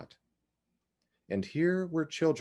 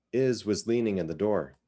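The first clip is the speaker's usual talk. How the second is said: ordinary delivery, nothing marked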